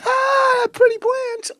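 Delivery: gasping, squealed high delight; hammed-up falsetto rapture over plants; mock overjoyed gushing. Husband shows how he doesn't act happy, squealing mock delight. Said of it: "But I'm not going".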